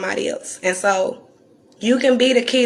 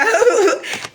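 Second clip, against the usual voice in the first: bright burst of high laughter; short amused laugh; 12 semitones higher than usual. Real, not acted. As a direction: laugh at her own joke. Laughs after own joke.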